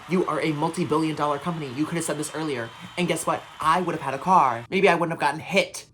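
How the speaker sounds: loud, emphatic venting; exasperated rant. Real, not acted. Customer berating Walmart over the wrong tires, exasperated rant. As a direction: lay into the company, loud and indignant.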